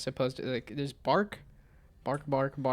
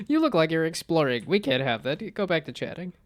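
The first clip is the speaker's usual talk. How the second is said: louder, higher playful scold; scolding game voice; 3 semitones higher, 6 dB louder than usual. Acted. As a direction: tease like a nagging game. Voicing the game's scolding message.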